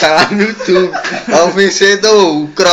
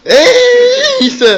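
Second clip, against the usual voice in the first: startled yelp. Real, not acted. Slap game, reacting to hit.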